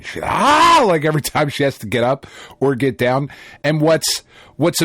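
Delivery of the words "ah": short high yelp of pain, mimicking her; high, loud copied scream of pain; imitated pain scream. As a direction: cry out "Ah!" like it hurts to stand up. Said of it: "she's legit screaming in pain".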